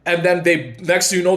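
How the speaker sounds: ordinary talk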